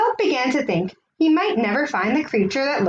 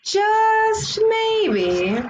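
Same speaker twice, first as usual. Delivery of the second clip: much higher, held hopeful phrase; hopeful lift; 10 semitones higher than usual. A narrator's reading. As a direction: float it up with hope. Hopeful realization.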